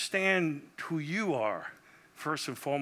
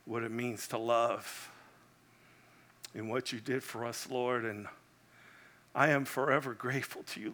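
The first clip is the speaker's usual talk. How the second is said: closing prayer; 3 semitones lower than usual. Real, not acted. Pastor closes in prayer.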